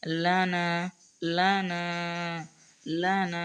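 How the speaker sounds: লানা with the long a held one alif count; one-alif stretch. Shown as a demonstration. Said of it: "এভাবে এক আলিফ পরিমাণ হইলে লানা".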